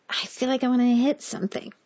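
high, pent-up complaint; frustrated outburst. A narrator's reading. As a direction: vent frustration at the heat. Frustrated, yanking at the sheet.

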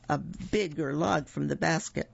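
ordinary delivery, nothing marked

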